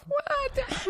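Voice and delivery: squeaky high mock outrage, very soft; a high squeal of fake offense; pretend-shocked yelp way up high; mock indignant what. Real, not acted.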